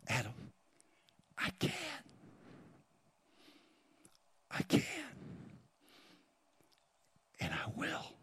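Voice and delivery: hushed whisper, spaced by long pauses. Acted. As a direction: whisper it softly, as God speaking privately to one listener. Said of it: "God is whispering".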